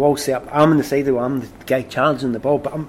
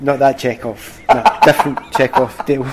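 laughing through the correction; chuckling as he corrects him; 5 dB louder than usual. Retold or imitated. Correcting teammate while laughing.

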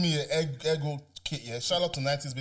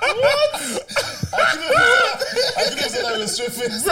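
laughing; 8 dB louder, slower than usual. Real, not acted. Cracks up at own joke.

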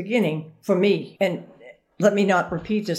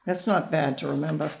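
ordinary talk, nothing marked

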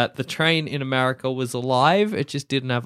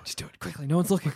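much softer, conspiratorial urging; hushed urging; 7 dB quieter, much faster than usual. Acted. Urging a bystander to kill Thomas, hushed.

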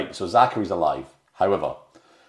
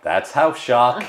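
mock movie Boston accent, slow; 5 semitones higher, 5 dB louder, slower than usual. Podcaster quoting the film's catchphrase, mock Boston accent. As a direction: drawl it like the guy in the movie, Boston. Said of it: "you gotta do it with the accent".

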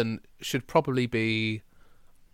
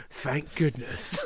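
mock relieved sigh; 8 dB quieter, much slower than usual. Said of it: "he's like".

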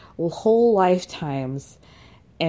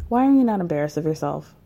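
usual speaking voice

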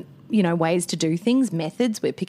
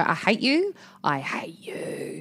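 hissed hatred; 5 dB quieter, slower than usual. Retold or imitated. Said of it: "I've just wanted to go".

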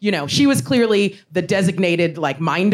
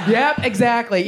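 ordinary talk

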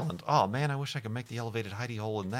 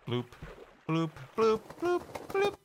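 bloop sounds; 11 semitones higher than usual. Retold or imitated. Imitating a sound effect.

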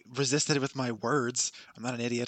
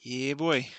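put-on frat-boy Minnesota accent. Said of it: "less Minnesota and more kind of frat".

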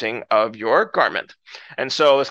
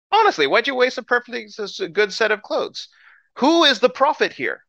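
higher-pitched, exasperated dry humor; Elisha; 8 semitones higher than usual. Retold or imitated. Elisha to the king who tore his clothes, drily exasperated. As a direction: chide them as if it were all obvious. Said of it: "English dry humor".

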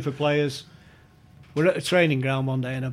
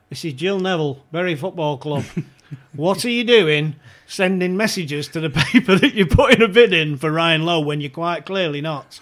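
telling off; 2 semitones higher, 11 dB louder than usual. Retold or imitated. Club chairwoman telling him off.

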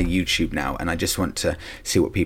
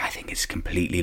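whisper; 8 dB quieter than usual. Real, not acted. Ranting, suddenly drops to whisper.

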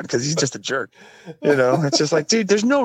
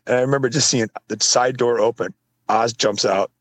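ordinary talk, nothing marked

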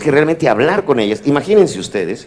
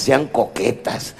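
ordinary delivery

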